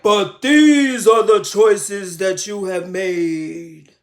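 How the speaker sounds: slow, low and loud, booming; solemn divine voice. Retold or imitated. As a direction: deliver it like a voice from heaven. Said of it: "a trembling voice".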